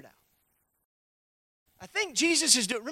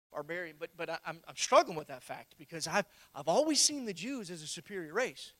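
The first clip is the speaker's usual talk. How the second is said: voicing Peter's confession; 6 semitones lower, 4 dB quieter than usual. Peter confessing his struggle to the congregation, hushed and low. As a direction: lower and softer, a troubled Peter's inner voice. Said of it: "Spirit-filled Apostle Peter is still struggling".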